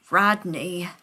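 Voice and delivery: ordinary delivery, nothing marked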